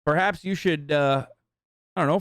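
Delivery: ordinary talk, nothing marked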